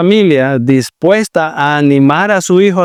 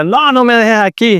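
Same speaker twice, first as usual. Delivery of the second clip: put-on child's plea; 7 semitones higher than usual. Imagined child refusing.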